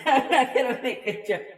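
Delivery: laughing through the words; can't hold back a laugh. Real, not acted. Laughs at own joke idea.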